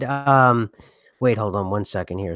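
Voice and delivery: ordinary talk, nothing marked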